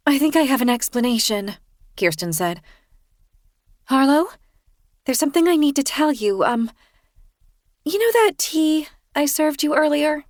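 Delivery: high, sheepish confession voice; Kirsten sheepish. A narrator's reading. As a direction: voice her high and apologetic.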